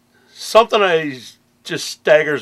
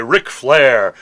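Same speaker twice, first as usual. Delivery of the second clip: ordinary talk, nothing marked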